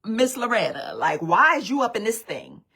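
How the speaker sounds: loud, loose street-casual take; casual homegirl voice. Shown as a demonstration. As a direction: talk to her like your friends would. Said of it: "the way I talk to y'all".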